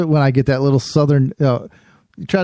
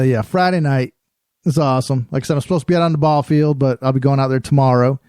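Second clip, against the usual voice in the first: ordinary talk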